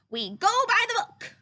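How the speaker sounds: high, loud insistent rabbit; loud fussy rabbit rule; Rabbit insisting. Acted. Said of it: "he told his plants".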